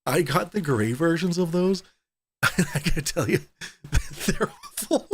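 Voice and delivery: talking through real giggles; laughing. Real, not acted. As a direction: crack up while confessing it. Cracking up confessing awful wall squares.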